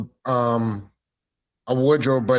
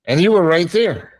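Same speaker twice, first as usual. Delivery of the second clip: louder, higher emphatic point; 6 semitones higher, 6 dB louder than usual. Real, not acted. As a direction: insist on it loudly. Emphatic loud reminder.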